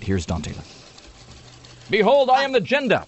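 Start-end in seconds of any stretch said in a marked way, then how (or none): 1.9-3.1 much higher, grand boss proclamation; hammed-up villain boss declaring itself; video game boss. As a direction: announce yourself as the level boss. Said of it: "second level boss in the new version of Metroid".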